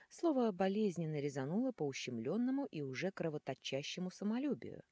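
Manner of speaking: ordinary delivery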